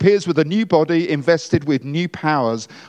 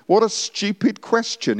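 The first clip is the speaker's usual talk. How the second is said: scornful Paul; 7 semitones higher than usual. Retold or imitated. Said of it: "he says".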